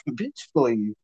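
light, knowing brush-off after a laugh; dismissive scoff. Retold or imitated. Showing how he answered her dare, dismissive scoff. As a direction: wave it away, amused. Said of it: "I said it exactly like that".

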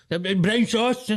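high, mocking take on his mate; teasing a friend's impression; mocking impression of his pal. Mocking friend's brown sauce obsession.

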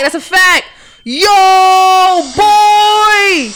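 high, loud hype; hyped shout. Real, not acted. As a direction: yell it out, hyped. Hype reaction.

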